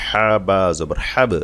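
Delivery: slow spelling, হাব ending in an echoed b; qalqalah recitation. Shown as a demonstration. Teacher asking students to watch closely, slow recitation with bouncing b in হাব. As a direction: draw out each letter, bounce the b of হাব. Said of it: "এই যে বা কলকলা".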